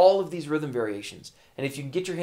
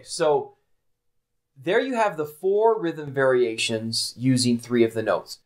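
ordinary talk

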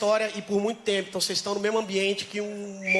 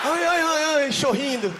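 mock whimpering; 4 semitones higher, 4 dB louder than usual. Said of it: "aqueles vídeos malucos".

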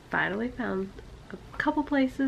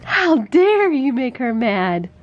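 mock scolding; 4 semitones higher, 11 dB louder, slower than usual. Mock scolding baby repeated.

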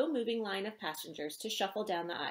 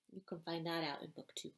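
soft teasing aside; 4 semitones lower, 10 dB quieter than usual. Real, not acted. Author's teaser aside.

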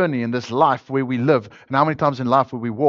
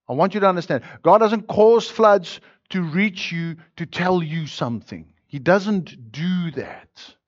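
fiery preaching; 6 semitones higher than usual. Acted. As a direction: build the sermon to a ringing peak. Preaching at full force.

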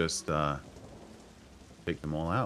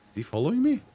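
high, worried question; suddenly alarmed; 13 semitones higher, 4 dB louder, faster than usual. Real, not acted. Enemy guard seems to follow.

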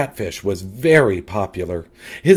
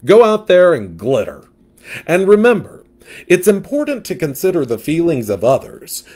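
louder, sassy gossip; sassy catfish; 4 dB louder than usual. Acted. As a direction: diva fish with attitude.

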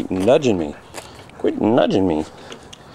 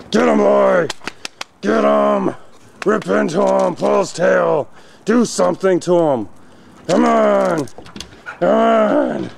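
egging on; 7 semitones higher, slower than usual. Real, not acted. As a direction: fire the dogs up, shout them on. Egging dogs into play-fight.

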